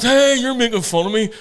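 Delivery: loud, high mock indignation; offended cleft-palate voice. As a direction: protest in a put-on cleft palate voice. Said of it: "I've got a cleft palate".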